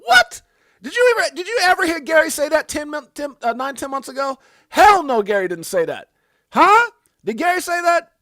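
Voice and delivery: loud, high incredulous outburst; hyped-up, shouted astonishment; mock disbelief. Real, not acted. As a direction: holler rhetorical questions in disbelief. Mock disbelief at regulator's crypto quote.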